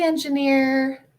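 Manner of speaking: ordinary talk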